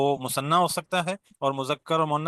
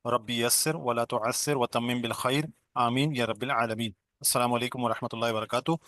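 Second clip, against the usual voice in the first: opening dua. Acted. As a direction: recite the dua gently before the lesson. Opening prayer and greeting. (धीमी आवाज़ में दुआ पढ़ना)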